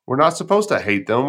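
ordinary delivery

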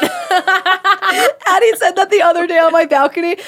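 talking through helpless laughter; laugh-crying while telling it. Real, not acted. Co-host laughing as she tells the balcony story. Laughing retelling of Addie's line.

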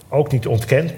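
usual speaking voice

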